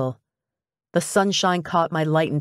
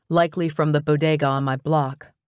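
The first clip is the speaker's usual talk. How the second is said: plain reading voice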